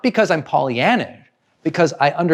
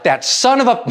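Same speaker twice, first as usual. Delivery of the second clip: higher, indignant half-finished curse; mock angry outburst; 9 semitones higher, 3 dB louder than usual. Driver cut off in traffic, mock angry. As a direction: imitate a driver losing it, pitch raised. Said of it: "they get completely angry".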